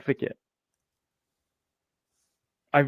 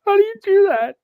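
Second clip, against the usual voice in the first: laughing hard; 5 dB louder, faster than usual. Real, not acted.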